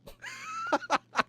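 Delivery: a sudden high laugh at the pose; burst of laughter. Real, not acted. Laughing at a friend's silly photo pose.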